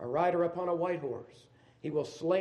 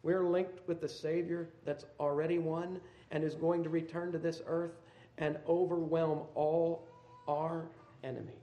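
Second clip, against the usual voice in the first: ordinary talk, nothing marked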